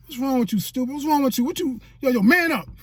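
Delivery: high, punchy mock scolding; scolding sister. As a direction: imitate a sister telling her brother off. Sister scolding him, acted out.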